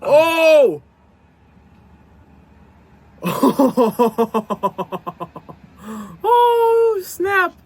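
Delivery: loud high shock; startled burst with laughter; surprised. Real, not acted. Shocked by fail clip.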